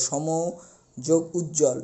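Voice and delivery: সমযোগ Ujjwal spelled out very slowly, part by part; slow breakdown. Shown as a demonstration. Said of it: "splitting the word slowly". (শব্দ ভেঙে খুব ধীরে বলা)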